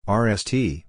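ordinary talk, nothing marked

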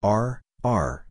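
ordinary talk, nothing marked

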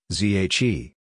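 normal talking voice

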